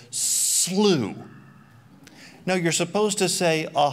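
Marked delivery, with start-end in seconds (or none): 0.1-1.2 mock misreading of the dollar sign as slew. Shown as a demonstration. Preacher showing the wrong reading of the dollar sign: "Slew" said as a word. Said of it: "you're not supposed to look at that and go".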